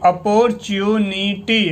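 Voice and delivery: अपॉर्चुनिटी read slowly, syllable by syllable; अपॉर्चुनिटी spelt out slowly for learners. Shown as a demonstration. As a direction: sound out अपॉर्चुनिटी slowly as a reading demo. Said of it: "शब्दों को कैसे पढ़ा जाता है इनको".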